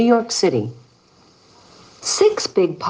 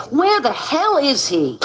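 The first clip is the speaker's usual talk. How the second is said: exasperated; 8 semitones higher than usual. Acted. Waiting, irritated.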